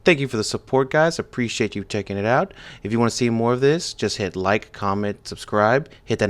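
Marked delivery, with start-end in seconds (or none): none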